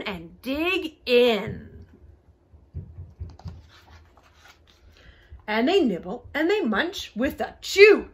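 lively, sing-song storybook reading; bouncy read-aloud with big pitch swings; story narration. A narrator's reading. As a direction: read a rhyming animal tale to children.

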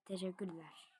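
whispered thanks. Real, not acted. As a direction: whisper it offhand. Quiet aside.

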